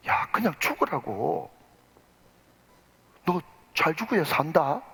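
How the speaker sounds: calm him down gently; soothing advice. Retold or imitated. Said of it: "조연출에 와가지고 이 사람한테 뭐라고".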